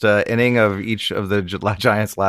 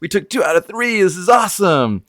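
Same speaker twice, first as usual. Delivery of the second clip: excited; 7 semitones higher than usual. Pretend. Imagined players cheering after series.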